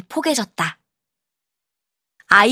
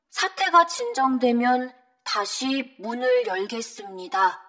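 soft, measured formal announcement; subdued official notice reading; library notice; 4 dB quieter than usual. Acted. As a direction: read out a closure notice quietly and evenly.

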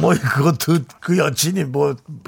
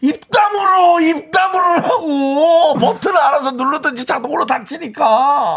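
high, drawn-out mock-furious yell; hammed-up outraged shouting; acted angry shout; 7 semitones higher, slower than usual. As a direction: holler at him to shut up. Acted outburst at the boyfriend.

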